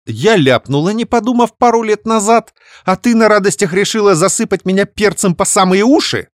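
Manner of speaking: high, loud bark. Acted. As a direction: bark it back in anger. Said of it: "рявкнула я". (рявкнула)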